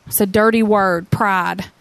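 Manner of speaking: normal talking voice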